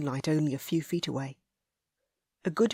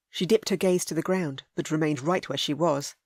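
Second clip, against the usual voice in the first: ordinary delivery, nothing marked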